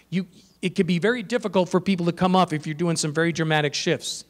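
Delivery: ordinary talk, nothing marked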